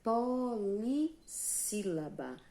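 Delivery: teacher announcing a new term; slow term reveal. Shown as a demonstration. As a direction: stretch "polissílaba" piece by piece. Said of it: "vai se chamar".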